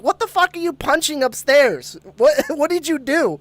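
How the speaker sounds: imitating a high-pitched yell at mom; retold yell. To mom upstairs after a bang, retold yelling. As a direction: call upstairs in mock outrage. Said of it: "So I yell at my mom".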